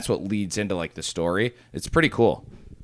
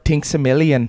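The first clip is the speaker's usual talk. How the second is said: put-on shitty Irish accent; 6 semitones higher, 6 dB louder than usual. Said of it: "shitty Irish accent".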